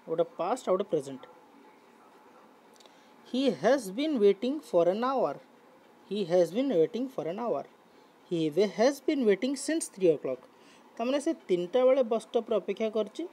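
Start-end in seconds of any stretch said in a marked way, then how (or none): 3.3-10.4 very slow, each word spelled out for the class; deliberate classroom dictation pace; slow model reading. Shown as a demonstration. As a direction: read it slowly so students can follow. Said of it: "teacher reads model sentences".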